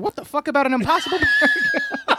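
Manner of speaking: laughing outrage. Retold or imitated. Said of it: "And I said".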